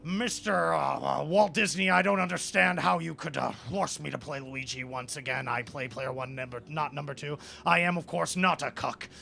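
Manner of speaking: loud, halting statesman voice full of uhs; hammed-up presidential bluster; Richard Nixon. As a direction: play an indignant, blustering president, starting loud. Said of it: "Richard Nixon says".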